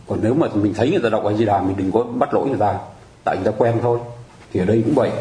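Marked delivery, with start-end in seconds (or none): none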